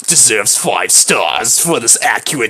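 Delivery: low, slow, haughty drawl; Miranda. Acted. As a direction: draw each word out low and grandly. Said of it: "I'll do Miranda, the same voice".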